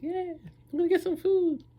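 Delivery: very high put-on voice; squeal-high, pretend glee; mock hopeful delight. Hungry person thinking food is coming, high mock delight. Acts out his hopeful thought.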